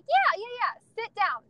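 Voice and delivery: high, loud, overeager agreement, retold; hasty cover-up. Mom covering for toddler before staring shoppers, high overeager agreement. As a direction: play along too brightly to cover up.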